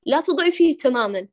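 ordinary talk, nothing marked

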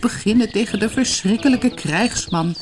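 high wailing rabbit, lamenting. A narrator's reading. Rabbit outside the burrow wailing, high and lamenting. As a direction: wail it out, a helpless little animal. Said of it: "Buiten jammerde hij".

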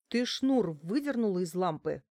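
plain reading voice

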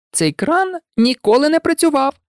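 high-pitched cheerful remark; cheerful girl ghost, lifted voice. A narrator's reading. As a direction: say it merrily, pitched well up. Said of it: "весело сказала Мірта".

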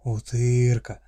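tender orc growl, loud; friendly monster voice. Acted. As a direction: growl it out affectionately. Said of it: "ласково прорычал орк".

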